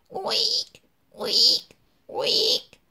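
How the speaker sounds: high whispered oinks of effort; breathy little piglet squeals; straining piglet squeals. A narrator's reading. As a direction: squeal like a pig pushing hard. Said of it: "fit Simon en s'efforçant de pondre".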